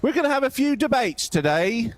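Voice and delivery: high-pitched mock Cockney announcer; high, exaggerated London cab driver accent; put-on British accent. Shown as a demonstration. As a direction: open a debate in a hammed-up British accent. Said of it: "when I put my British accent on".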